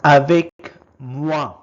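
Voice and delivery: first take, projected clearly for the class; loud model word. Shown as a demonstration. Teacher modelling a French word for learners, loud and clear. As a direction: call out the French word loudly. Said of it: "is called".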